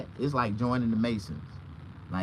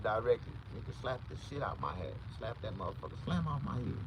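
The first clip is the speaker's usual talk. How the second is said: usual speaking voice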